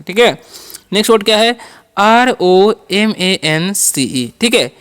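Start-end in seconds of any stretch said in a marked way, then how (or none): 2.0-4.3 slow letter by letter: आर, ओ, एम, ए, एन, सी, ई; spelled out slowly. Shown as a demonstration. Teacher spelling the word slowly to the class. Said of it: "तो इसे कैसे पढ़ेंगे".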